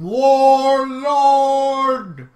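high, loud drawn-out warlord; sustained playful yell; mock holler. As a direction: holler it long and high, hammed-up. Reads game option, hollers the word.

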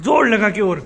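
coaxing shout at a shy nephew; urging shout. Real, not acted. Urging child to speak louder.

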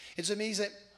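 normal talking voice

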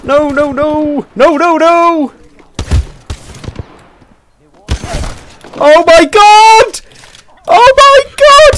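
excited yelling. Real, not acted. Excited gamer at a clutch moment.

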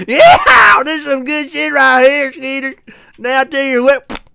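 whooping, high mock cowboy twang. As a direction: open on a loud cowboy yee-haw. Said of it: "I'll do the cowboy voice".

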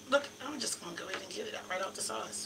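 usual speaking voice

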